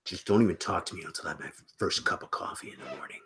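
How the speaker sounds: mock grumpy, whispered. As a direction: hiss it like a grouch before coffee. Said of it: "I'm grumpy and irritable".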